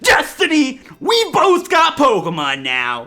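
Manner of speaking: hammed-up rival challenge, loud and high. Rival taunting the player to battle, hammed-up shouting. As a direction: rev up like a cocky rival trainer. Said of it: "Faith is about to rev up and say".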